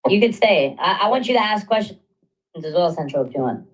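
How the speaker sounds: ordinary talk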